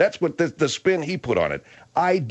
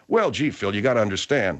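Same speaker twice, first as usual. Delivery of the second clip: lower-pitched mock excuse; mocking imitation; 6 semitones lower than usual. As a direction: drop down to parody their feeble excuse. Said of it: "you guys throw me to the wolves".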